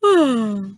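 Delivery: put-on sleepy girl yawning again; second yawn. A narrator's reading. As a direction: let the yawn fade. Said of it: "and yawned".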